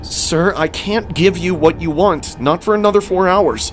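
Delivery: lightly voiced exasperated counter worker; insistent cashier. A narrator's reading.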